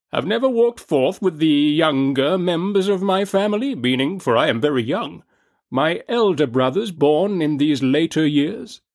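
high, booming merry giant; jolly ghost voice. A narrator's reading. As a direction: press on, big and merry. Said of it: "pursued the phantom".

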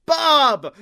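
high, loud Bulgarian bark of a name; Bulgarian accent call. Retold or imitated. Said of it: "cutting this great promo".

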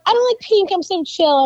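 high, airy mock cool-girl voice; mock the girls who act chill; too-cool girl. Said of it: "when girls are like".

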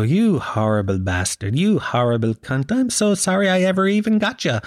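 lilting affectionate cat talk, ending high; nice pet voice. Shown as a demonstration. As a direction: coo the insults while petting the cat. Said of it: "rubbing her and talking in that voice".

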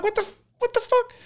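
stunned that they simply left; incredulous what the fuck. Retold or imitated. Telling friends the firefighters just walked off, high baffled exclamation.

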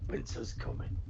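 unvoiced hush on "Winter's coming"; whispered mock Sean Bean. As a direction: breathe it like a secret. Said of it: "It's just".

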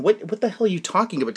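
normal talking voice

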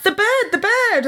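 high, excited mock alarm; excited cry. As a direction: cry out like a startled crowd. Everyone panics about the bird.

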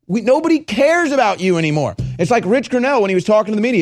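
ordinary talk, nothing marked

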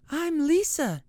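put-on very high bright child's voice; little girl. Girl begging her mother for the bear, high child voice. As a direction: squeal it like an excited little girl.

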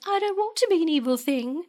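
lightly voiced whiny plea, pitched high; a hint of a distraught girl; whiny Drew voice. Friend retelling Drew's plea, mock whiny.

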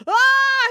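very high scream; high, startled "ah"; falling cry. A narrator's reading. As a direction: cry out as you drop. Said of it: "he cried".

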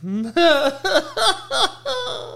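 high, trailing laughing fit. Real, not acted. Comedian laughs at his mom bit, high laughter. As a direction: crack up at your own impression.

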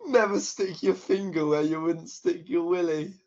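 reading through giggles; can't keep a straight face; laughing. Shown as a demonstration. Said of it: "we gotta try not to laugh".

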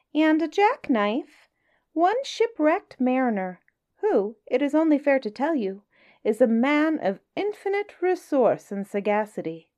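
high, sly little fish voice; small astute voice. Acted. Small clever fish flatters the whale, high sly voice. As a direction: sweet-talk the whale in a tiny clever voice.

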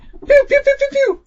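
very high-pitched pew sounds; imitated firing noises. Player retelling his grenade attack, squeaky imitated firing. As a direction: squeak out a rapid string of toy-gun pews.

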